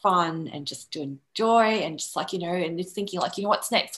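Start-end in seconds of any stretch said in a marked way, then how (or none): none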